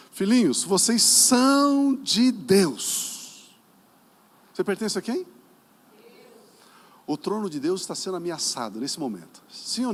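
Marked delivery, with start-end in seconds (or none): 0.1-3.6 very loud, drawn-out, high proclamation; booming proclamation. Real, not acted. Preacher proclaims the congregation's identity, booming drawn-out cry. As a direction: stretch SÃO out long and loud. Proclaims verse again at full force.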